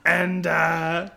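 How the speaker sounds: Gru. Acted. Villain dad tells the girls off, put-on European accent. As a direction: scold lightly in a cartoon European accent. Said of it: "[name], your turn".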